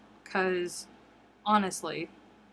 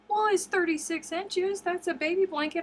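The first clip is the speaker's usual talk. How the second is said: matter-of-fact cousin; 10 semitones higher, 4 dB louder than usual. Retold or imitated. Voices cousin Amy defending.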